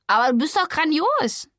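high, loud indignant outburst; indignant cry. A narrator's reading. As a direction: protest loudly, outraged. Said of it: "rief Hotte empört".

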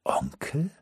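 soft, rising, disbelieving "Onkel?"; surprised question. Acted. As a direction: ask "Onkel?" quietly, pitch climbing. Almut surprised at the word.

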